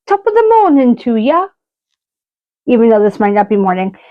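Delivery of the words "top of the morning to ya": a light, hammed-up Irish accent; bad Irish accent. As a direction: toss off a cheesy Irish greeting in a bad Irish accent. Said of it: "my accent is complete bollocks".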